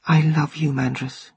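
quiet, fading to almost nothing; soft declaration. Acted. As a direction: whisper it to yourself in bed. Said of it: "she declared".

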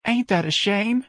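high, drawn-out pity; emotional. Acted. Mrs. Riley hearing of a death, emotional. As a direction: lament it slowly in a high voice. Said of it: "said emotionally".

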